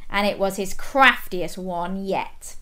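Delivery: plain reading voice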